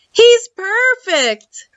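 high, drawn-out delight; delighted boy. A narrator's reading. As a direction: stretch it out high, thrilled with the find. Boy delighted with old robot.

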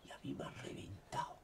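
low whispered aside. Real, not acted. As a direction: drop to a whisper.

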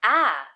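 ah as a short open æ vowel; short a sound. Shown as a demonstration. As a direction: model the ah sound cleanly. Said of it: "sometimes the short A".

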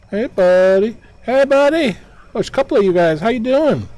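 high, loud friendly call; calling out to ghosts. Real, not acted. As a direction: shout a cheery hello across the water. Greeting ghosts loudly.

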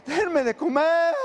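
hungry speaker begging to be fed, high and slow; comic plea. Acted. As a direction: beg for food like a starving man.

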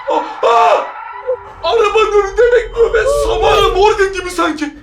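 high, panicked yelps of fright; scared shriek, voice pitched high; frightened cry. Real, not acted. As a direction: cry out in real terror at the ghost. Ghost waits in front of car.

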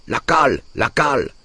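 high-pitched urgent cry; shouted order. A narrator's reading. Peasant pushing the cart calls for the wedge, shouted. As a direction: call it out high, falling at the end.

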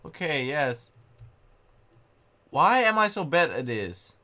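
ordinary talk

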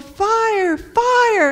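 high, drawn cry of alarm, acted; storyteller's yell. A narrator's reading. David spotting smoke and warning Mom, acted yell. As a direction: call out a warning as the boy in a children's story. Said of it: "And he yelled".

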